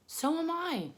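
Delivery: high little spider voice; tiny voice. Acted. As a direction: pipe it up high. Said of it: "said another tiny voice".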